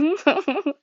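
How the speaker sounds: high-pitched giggle; amused giggle. Real, not acted. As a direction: burst into a brief high laugh. Laughs at forgetting what was heard.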